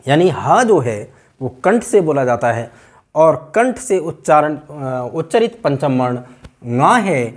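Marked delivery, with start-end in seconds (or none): none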